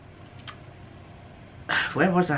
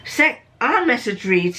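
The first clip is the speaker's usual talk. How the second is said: ordinary talk, nothing marked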